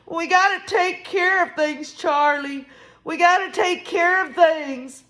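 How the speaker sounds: high, wailing plea; mock tearful insistence; imitated weeping. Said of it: "emotionally weeping".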